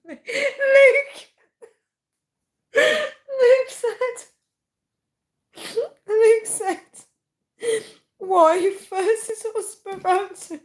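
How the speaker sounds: high squeaky words through tearful laughter; uncontrollable laughing. Real, not acted. Streamer reading out Luke's comment, crying with laughter. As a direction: keep restarting, choked with laughter. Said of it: "I need to stop laughing".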